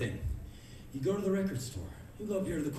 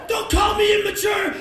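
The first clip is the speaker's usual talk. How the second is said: high, very loud furious yell; screamed protest; 11 dB louder than usual. Pretend. Comic screaming at the stranger, furious.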